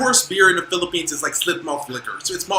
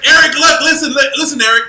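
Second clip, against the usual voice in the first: loud, high call for attention; 8 semitones higher, 6 dB louder than usual. Real, not acted. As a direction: call him over across the room. Calling out to Eric.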